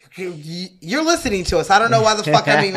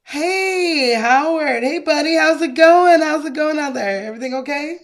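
high, sing-song buddy greeting; chummy neighbour; 8 semitones higher than usual. Acted. Neighbour greeting Howard on the phone, overly chummy. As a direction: gush hello in a high lilt.

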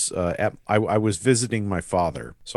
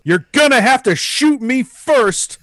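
high, loud, drawn-out heroic cry; defiant yell; 14 semitones higher, 7 dB louder than usual. Retold or imitated. Seven-year-old defying an angry neighbor, high defiant yell. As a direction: yell it with supreme bravery. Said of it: "looked at him and yelled".

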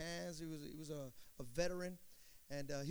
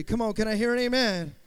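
loud, ringing call to the church; call for amen; 2 semitones higher, 13 dB louder than usual. Real, not acted. Preacher calling his congregation for an amen, loud and ringing. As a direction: belt it out to the crowd.

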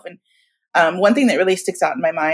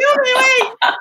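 laughing through tears. Retold or imitated.

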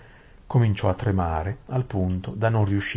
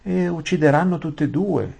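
higher, louder fearful retort; frightened maid; 9 semitones higher, 5 dB louder than usual. Acted. Saralin answers Aravis in fright, higher. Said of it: "disse la Saralin".

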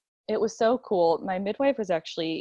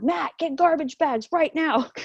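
high-pitched mock yell; mild, high yelled command; retold yell; 8 semitones higher than usual. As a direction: imitate the midwife's urgent order without full volume. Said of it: "So she yelled".

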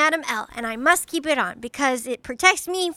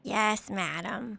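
meek; 7 semitones lower, 9 dB quieter, slower than usual. Acted. Scolded child answers meekly.

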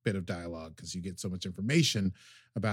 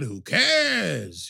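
dismissive; 5 semitones higher, 5 dB louder than usual. Pretend. Dismissive aside in odd voice.

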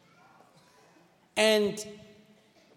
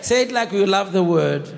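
loud, slightly lower rallying call; loud preacher's prompt for a response; rousing call to the congregation; 3 semitones lower, 5 dB louder than usual. Real, not acted. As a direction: urge the crowd to say it back louder.

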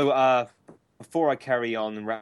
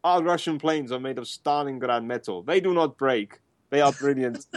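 put-on Russian accent; 6 semitones higher than usual. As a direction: boast like a Soviet patriot. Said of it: "in Ru-, in".

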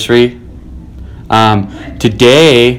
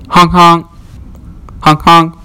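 higher, nasal-free mouth "honk honk" like a car horn; car horn imitation; 7 semitones higher than usual. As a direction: beep like a car stuck in traffic. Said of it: "Here, here is cityscape".

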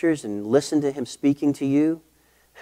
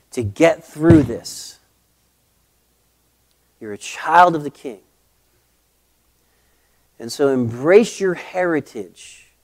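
fervent, louder pastoral encouragement; preacher voicing Paul, building to a stirring charge; Paul encouraging Timothy; 5 dB louder than usual. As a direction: lift him up like a mentor who believes in him. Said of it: "Paul ... point out with Timothy".

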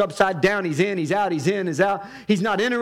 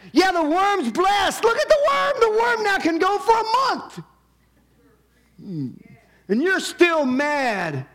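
raised, pushing preacher's voice as God; voicing God; 6 semitones higher than usual. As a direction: speak as God scolding Jonah, climbing high. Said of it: "God is trying to say".

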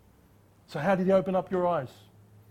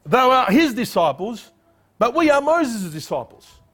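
louder, higher mock scorn; reviling sneer; 6 semitones higher, 6 dB louder than usual. Pharisees reviling the healed man, loud mocking scorn. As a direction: spit out the insult slowly. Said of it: "They reviled him".

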